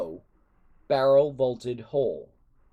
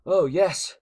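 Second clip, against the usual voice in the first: sarcastic; 5 semitones higher, 6 dB louder than usual. A narrator's reading. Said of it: "said Hiccup sarcastically".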